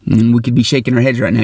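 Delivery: ordinary talk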